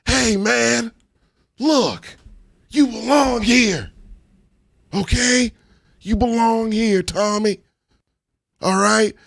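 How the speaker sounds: high, slow reassuring "you belong here". Retold or imitated. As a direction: reassure him with dead eyes. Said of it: "You're like".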